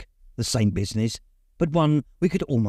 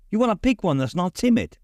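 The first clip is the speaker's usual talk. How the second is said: lightly voiced father, higher, gently instructive; a hint of a dad coaching his boy; 8 semitones higher than usual. A narrator's reading. Father coaching his son at the puppy cage, lightly voiced coaching. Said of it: "my father coached".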